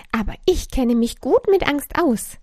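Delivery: small creature showing off a bit; proud voice. A narrator's reading. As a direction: announce it with pride as the little creature. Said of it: "mit stolzer Stimme".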